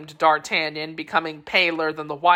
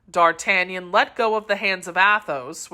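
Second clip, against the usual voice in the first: usual speaking voice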